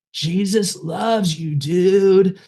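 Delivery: high, drawn-out stoner drawl; hippie stoner. Retold or imitated. Hippie stoner friend tells a depressed kid he is loved, stoner drawl. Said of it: "like, his tone of voice".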